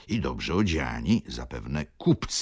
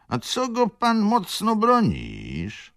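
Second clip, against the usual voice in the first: bass voice; 6 dB louder, slower than usual. A narrator's reading. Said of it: "spytał bas".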